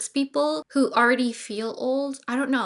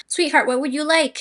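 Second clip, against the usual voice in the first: lightly voiced gentleman, louder and higher; take-charge date; 4 semitones higher, 6 dB louder than usual. Host voicing how the man should have asked, take-charge date. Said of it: "taking charge and saying".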